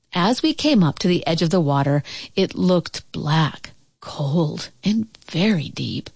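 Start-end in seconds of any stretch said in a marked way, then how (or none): none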